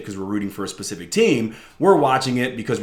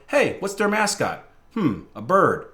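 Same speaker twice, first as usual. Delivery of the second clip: lilting mock deliberation, ending higher; wife choosing casually; 6 semitones higher than usual. Husband imitates his wife picking teams by mascot, casual musing. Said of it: "she's going through the list like".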